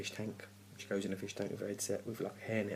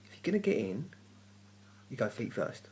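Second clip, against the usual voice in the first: exasperated rant; 4 semitones higher, 5 dB louder than usual. Real, not acted. Ranting advice.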